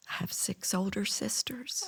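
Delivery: imitating a shy client in a whisper; soft, airy whispered reply; timid confession. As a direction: whisper it like a meek man.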